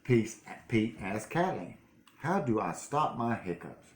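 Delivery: plain reading voice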